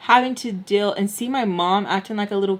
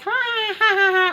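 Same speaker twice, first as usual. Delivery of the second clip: high forced "ha ha"; mock someone laughing along; put-on laughter without any amusement; fake laugh; 12 semitones higher, slower than usual. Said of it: "sit here and be like".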